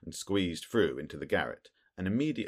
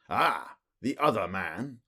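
sneering; 6 semitones higher, 5 dB louder, slower than usual. A narrator's reading. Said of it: "in a sneering voice".

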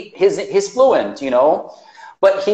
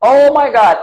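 high, loud excited exclamation; thrilled, pitched up and loud; delighted surprise; 8 semitones higher, 7 dB louder than usual. Real, not acted. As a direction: burst out in real excitement. Delighted that viewer is from Angola.

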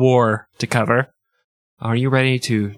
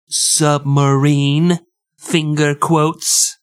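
slow, drawn-out condescending submarine; mock condescension; 3 semitones higher, much slower than usual. Host mocking ignorant Americans, slow condescending drawl. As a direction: sneer at the Americans word by word. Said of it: "you stupid, ignorant Americans".

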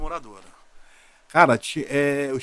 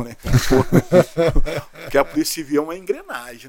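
laughing wryly; 4 dB louder than usual. Real, not acted. Laughing at credit taken.

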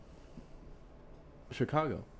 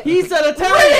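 high-pitched, very loud protest; exasperated shout; 14 dB louder than usual. Real, not acted. Explaining the mix-up to friends, exasperated shouting. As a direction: yell your case over the heckling. Shouted argument about accent.

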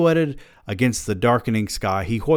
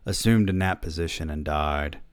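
ordinary talk

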